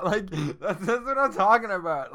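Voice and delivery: high and loud, laughing through the words; delighted laughing shout; laughing excitedly. Real, not acted. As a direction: crack up while you cheer it on. Laughing over Tom Cruise dance.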